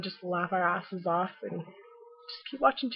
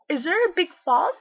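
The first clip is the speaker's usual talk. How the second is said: clueless tourist; 8 semitones higher, 10 dB louder than usual. Retold or imitated. Tourist asking for the falls beside the window, loud, high mock. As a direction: ask it like a dopey tourist. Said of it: "they call it the Big Falls".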